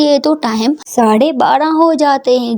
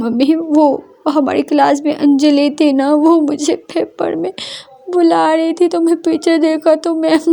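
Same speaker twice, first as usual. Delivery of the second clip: a hint of tears as she explains; tearful explaining; 3 semitones higher than usual. Daughter explains to mother what happened in class, lightly tearful.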